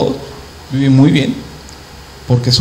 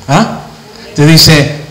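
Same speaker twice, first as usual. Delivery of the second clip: usual speaking voice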